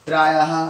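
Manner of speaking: प्रायः loud, with a clear final ha; visarga demo. Shown as a demonstration. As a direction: punch the ha at the end of प्रायः. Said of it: "last में हा का आएगा".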